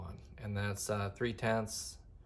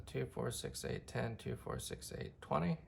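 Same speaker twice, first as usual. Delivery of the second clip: counting under breath; 2 semitones higher, 9 dB quieter than usual. Real, not acted. Counting off the scale to himself.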